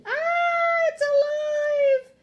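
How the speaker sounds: very high, drawn-out shriek; loud high screech of alarm; terrified scream. A narrator's reading. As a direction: scream in horror, slow and high. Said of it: "The lady screamed".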